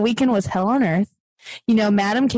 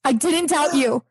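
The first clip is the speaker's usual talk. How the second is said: mock scared plea; 7 semitones higher than usual. [name] pleading with madam.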